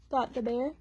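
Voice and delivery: usual speaking voice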